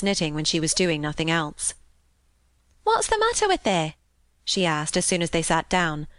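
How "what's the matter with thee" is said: high-pitched Yorkshire maid voice; lilting, high servant girl voice. A narrator's reading. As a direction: ask it in high northern dialect. Narrator voices maid's dialect question.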